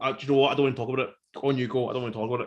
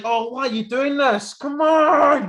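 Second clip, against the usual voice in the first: raised, high-pitched pleading complaint building to a loud cry; exasperated protest; 10 semitones higher, 6 dB louder, slower than usual. Retold or imitated. Viewer pleading with the reckless brothers on screen, exasperated. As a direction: plead with them in disbelief, stretching the last words.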